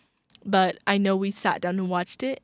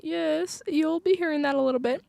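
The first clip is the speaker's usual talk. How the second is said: high, put-on British accent; airy mock-British aside, pitched up; 7 semitones higher than usual. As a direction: playfully keep up the British voice. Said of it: "I just slipped into my British accent".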